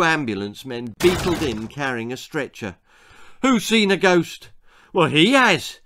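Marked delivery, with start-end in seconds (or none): 3.4-4.5 urgent call. A narrator's reading. Ambulance man bursts in. 4.9-5.8 loud, high shout. A narrator's reading. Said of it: "shouted Arthur".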